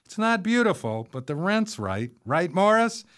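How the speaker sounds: high-pitched, chummy pitch; brash exclaiming. A narrator's reading. Artist selling her home to new neighbors, high and brash. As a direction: push it up high, seeking agreement.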